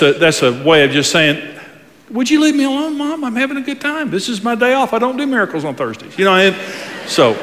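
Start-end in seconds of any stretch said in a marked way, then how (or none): 2.1-6.2 much higher, softer mock teenage whine; annoyed son. Preacher voicing Jesus brushing off his mom, mock whine. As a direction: whine it like a put-out teen.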